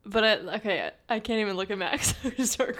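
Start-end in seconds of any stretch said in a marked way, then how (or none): none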